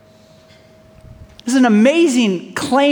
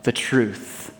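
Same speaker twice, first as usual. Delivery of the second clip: soft solemn claim; 8 semitones lower, 5 dB quieter, much slower than usual. Acted. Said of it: "He also said".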